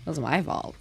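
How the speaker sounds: normal talking voice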